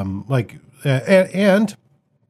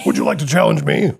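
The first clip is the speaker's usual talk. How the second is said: anime voice; 3 semitones higher than usual. Pretend. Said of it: "my anime voice".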